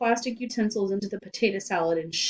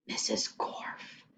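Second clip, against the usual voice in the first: breathy hushed whisper, slow; 8 dB quieter, slower than usual. Acted. Boy whispering a secret name to Joe, hushed whisper. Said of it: "he whispered".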